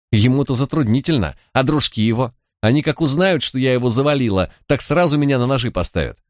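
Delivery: put-on Manya, young thief. A narrator's reading. Manya voiced in audiobook.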